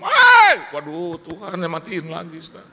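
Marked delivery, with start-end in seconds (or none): 0.0-0.7 explosive, high imitated yell; sudden shout. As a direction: blast a startling yell down the phone. Debt collector bursts out on phone. (bentakan tinggi menggelegar)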